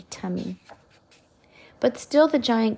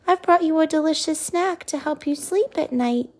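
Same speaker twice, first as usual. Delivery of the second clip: Sophie; 8 semitones higher than usual. A narrator's reading. Princess voiced, high.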